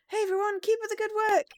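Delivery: loud, high greeting to a whole crew; cheerful calling out. Acted. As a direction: call out brightly across the lot. Character calls out to investigators.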